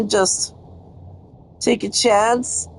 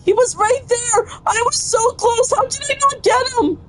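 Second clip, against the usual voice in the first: much higher, exasperated cry after a miss; frustrated outburst; 14 semitones higher than usual. Real, not acted. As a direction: yelp in disbelief at the near miss. Just missed the demon.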